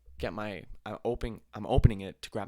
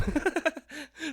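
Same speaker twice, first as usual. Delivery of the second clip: high-pitched burst of giggles; bursting laugh. Real, not acted. Man admits he says it too, high giggling laugh. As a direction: crack up at your own remark. Cracks up at own remark.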